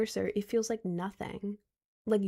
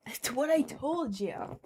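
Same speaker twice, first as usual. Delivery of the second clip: told you so; 4 semitones higher, 3 dB quieter, slower than usual. Real, not acted.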